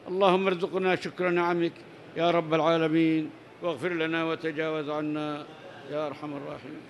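low, soft prayer fading away; hushed supplication. Real, not acted. Praying to God for gratitude and forgiveness, quiet supplication. Closing supplication after the hadith.

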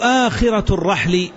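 "آخرة" with a long opening aa, aa-khira; lengthened hamza. Shown as a demonstration. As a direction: draw out the first vowel of "آخرة". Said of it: "بهمزة ممدودة".

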